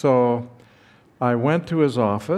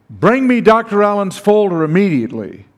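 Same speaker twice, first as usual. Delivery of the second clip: loud, raised command; angry order; 6 semitones higher, 7 dB louder than usual. Retold or imitated. Furious dean demanding the folder from his secretary, loud and commanding.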